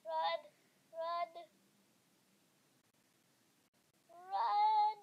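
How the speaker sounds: urgent loud urging; being chased. Real, not acted. Fleeing the seeker.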